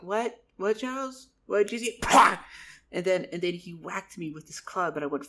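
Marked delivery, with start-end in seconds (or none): 0.0-2.0 higher and louder confused calls; calling out, not hearing the warning; confused shouting. Acted. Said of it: "it's like". 2.0-2.4 a loud mouth-made impact pop; loud mouth-made club strike; loud whack noise. Acted. Club whack sound effect.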